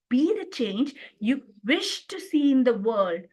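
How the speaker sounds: brief break, then WISH emphasised; stress on wish. Shown as a demonstration. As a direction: pause after you and lean on wish. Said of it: "the emphasis is laid on the word wish".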